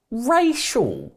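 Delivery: high, loud, drawn-out mock outcry. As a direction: cry it out as a silly punchline. Comic punchline to his racism joke.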